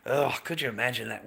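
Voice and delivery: normal talking voice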